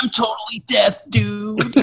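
high-pitched slacker cartoon voice; goofy cartoon dude. Joking as a recruited Horseman, high cartoon dude.

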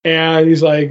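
usual speaking voice

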